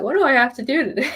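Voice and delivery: fast, laughing self-quote. Retold or imitated. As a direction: giggle through the question.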